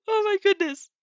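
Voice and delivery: high, loud, laughing through the words; real laughing fit. Real, not acted. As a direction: crack up and gasp it out. Reader cracks up at the scene.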